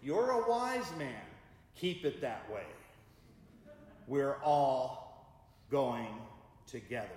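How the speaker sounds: wife closing the debate with her husband, firm; firmly. Retold or imitated. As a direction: settle it firmly, no arguing. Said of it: "she said firmly".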